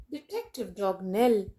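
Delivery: plain reading voice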